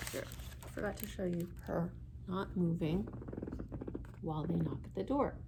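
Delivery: ordinary delivery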